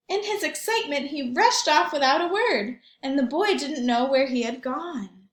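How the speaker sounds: put-on lively read-aloud for young children; story narration. A narrator's reading. Teacher reads picture book aloud.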